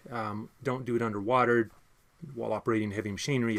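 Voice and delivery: ordinary talk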